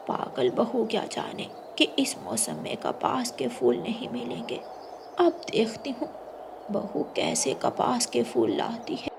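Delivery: hushed, whispered gloating; scheming aside. Acted. Mother-in-law secretly gloats over the impossible task, in a hushed whisper. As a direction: whisper it to yourself like a sly plot.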